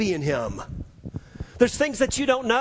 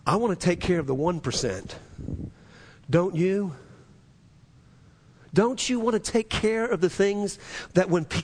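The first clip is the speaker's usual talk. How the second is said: normal talking voice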